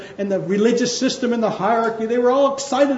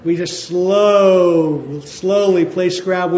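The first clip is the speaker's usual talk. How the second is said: slow, unhurried words; much slower than usual. Shown as a demonstration. Son describing slow Scrabble with parents, unhurried. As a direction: ease the words out slowly. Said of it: "Playing Scrabble with them is slow".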